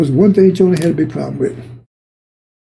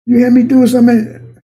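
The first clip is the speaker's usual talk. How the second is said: angry Jonah; 6 semitones higher than usual. Acted. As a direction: fire back at God with rising outrage. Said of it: "he was really angry about it".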